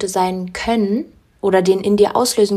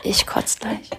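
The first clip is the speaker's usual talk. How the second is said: whispering; 4 dB quieter, slower than usual. Real, not acted. Mock disgust aside.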